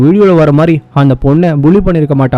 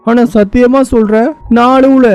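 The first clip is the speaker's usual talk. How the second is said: high, acted weepy protest; tearful confusion; 6 semitones higher than usual. Confessing tears that make no sense, tearful confusion. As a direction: admit and deny crying in a pitched-up voice. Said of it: "நான் தான் அழுவுறேன்".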